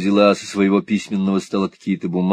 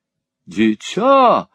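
higher, long drawn-out exclamation; cried out; 7 semitones higher, 5 dB louder, much slower than usual. A narrator's reading. Mantalini bursting into the room, drawn-out cry. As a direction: stretch the word in theatrical surprise. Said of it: "вскричала Монталини".

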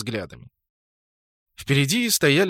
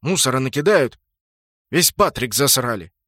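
louder, irritated complaint; angry grumble; 5 dB louder than usual. A narrator's reading. Sprut kicks a crate over the litter, angry grumbling. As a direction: complain crossly with some force. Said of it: "Он со злости пнул".

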